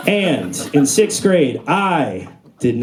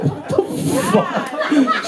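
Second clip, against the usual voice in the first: very high squeal of shock; high-pitched horrified woman; 12 semitones higher, much slower than usual. Retold or imitated. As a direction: squeak it like a startled stranger. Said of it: "She's like".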